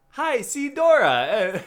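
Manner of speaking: high, loud, drawn-out greeting with a laugh; Dora catchphrase. Retold or imitated. Co-host mimicking a cartoon girl's catchphrase, loud and high. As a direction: draw out a big high-pitched hello. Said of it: "That's, like, her catchphrase".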